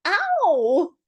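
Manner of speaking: sudden high squeaked ow; small creature squeaks in pain. A narrator's reading. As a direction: yelp it out high and tight. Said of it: "squeaked something".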